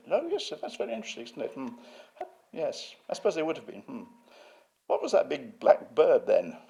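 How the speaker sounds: high, hurried mock sneer; David Wilson. Museum head inspecting the exhibit, imitated as a high sneerer. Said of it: "he is a sneerer".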